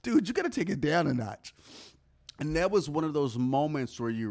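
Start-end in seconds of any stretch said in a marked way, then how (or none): 0.0-1.6 imitating the Boston girl. Girl at the bar teasing the overdressed newcomer, mock high voice. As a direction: tease him about being overdressed. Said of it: "She was from Boston".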